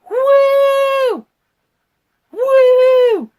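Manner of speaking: very high, loud whooping beam sound; long sliding woo like a sci-fi ray; piercing mock laser whoop; imitated beam noise.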